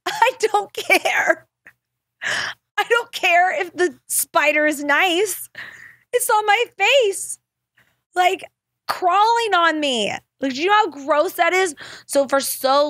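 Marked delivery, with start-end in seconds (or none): 0.1-10.1 high-pitched protest. Retold or imitated. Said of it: "And I'm like".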